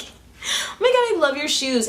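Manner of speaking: high-pitched, drawn-out squeal of admiration; gushing compliment. Retold or imitated. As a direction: gush in a high voice, stretching the opening. Gushing compliment she would blurt.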